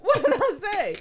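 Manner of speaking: high, loud, laughing told-you-so; mock indignation. Real, not acted. Co-host proved right by the online list, mock-indignant crowing. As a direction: crow it loudly through laughter. Playful vindication after list agrees.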